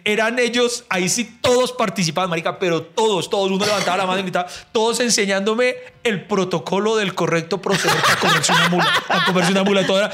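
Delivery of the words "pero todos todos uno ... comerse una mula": ordinary talk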